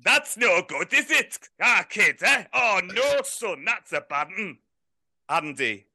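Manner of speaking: lightly voiced Yorkshire manager, chuckling; impression of Greg Abbott. Manager on a prank call to a footballer, mock gruff. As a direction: play the gruff manager on the phone.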